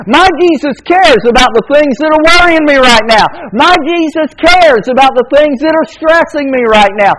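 cadenced pulpit litany; preaching. Acted. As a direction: chant the refrain to lift them. Repeated affirmations to congregation.

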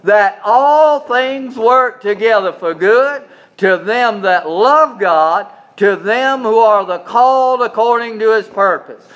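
reading scripture aloud, stressing I; congregational reading aloud. Shown as a demonstration. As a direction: lead the room through the verse. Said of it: "Let's do it again".

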